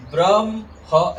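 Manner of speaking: ब्रह्म misread as "bram-ha", the म before the ह; wrong pronunciation. Shown as a demonstration. Teacher warns students how not to say ब्रह्म, wrong-order demo. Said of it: "ऐसे नहीं बोलते हैं".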